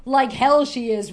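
loud, slow roar with a laugh in it. A narrator's reading. Businessman roaring at the construction worker, hammed-up roar. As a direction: bellow it out, hammed-up. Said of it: "roared the businessman".

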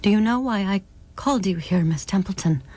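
ordinary delivery, nothing marked

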